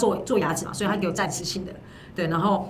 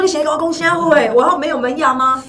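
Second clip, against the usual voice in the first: 1 indignant retort; 8 semitones higher, 7 dB louder than usual. Retold or imitated. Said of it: "我想说".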